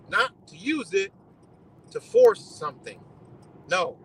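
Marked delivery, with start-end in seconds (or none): none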